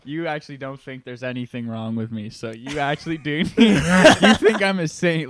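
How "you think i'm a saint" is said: slow, loud laughing line. Real, not acted. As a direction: laugh the words out slowly.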